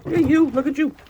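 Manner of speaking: high and loud, teasing; high loud pet talk; pet baby talk. Acted. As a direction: gush at the mud-covered bird. Teasing muddy chicken.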